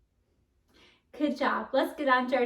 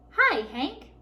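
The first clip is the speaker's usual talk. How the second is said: bright, louder greeting from afar; called out; 4 semitones higher, 7 dB louder than usual. A narrator's reading. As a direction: call out a cheerful hello. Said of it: "called out".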